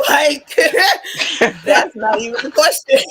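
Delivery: laughing through the words. Real, not acted. Laughing to her friends at the silly question. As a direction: laugh it off as obvious.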